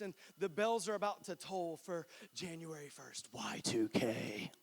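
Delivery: hushed, slowing down to the moment; spooky whisper, slow; dramatic whisper. Acted. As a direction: whisper it like a suspense buildup.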